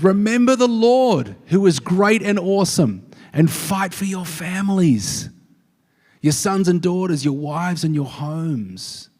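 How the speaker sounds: rally them like a general; stirring battle speech. Acted. Preacher voicing Nehemiah rallying the people, loud and high.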